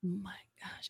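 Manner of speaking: breathless whispered oh my gosh; shocked whisper. Real, not acted. Podcast host reacting to school gossip, whispered shock. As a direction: gasp it out under your breath. Gasp at the news.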